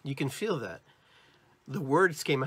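usual speaking voice

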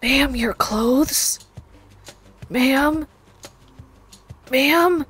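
loud, high shocked protest; hammed-up shock, pitched high; scandalized. Real, not acted. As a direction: call out "ma'am" in rising disbelief. Scandalized by undressed game character.

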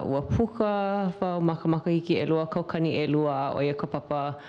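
ordinary delivery

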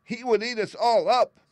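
very high, loud mouse-queen squeal; alarmed squeaky cry. A narrator's reading. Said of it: "cried the little Queen".

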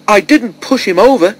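high-pitched, alarmed protest; cry of alarm. A narrator's reading. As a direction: cry out in alarmed self-defence. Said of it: "she cried in alarm".

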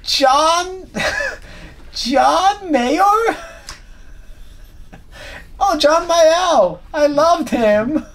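put-on very high, slow, confused elderly voice; mock an ancient fan mishearing a name; imitated old person. Old cruise passenger answers musician, high elderly voice. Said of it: "eight hundred years old".

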